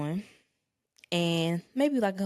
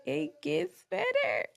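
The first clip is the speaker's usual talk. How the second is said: laughing; 8 semitones higher, 4 dB louder than usual. Real, not acted. Laughing while telling the story.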